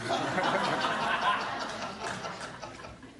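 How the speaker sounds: breathy unvoiced chuckle; soft laugh. Real, not acted. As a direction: laugh quietly at your own joke. Judge laughs at own joke.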